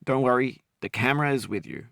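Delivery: ordinary delivery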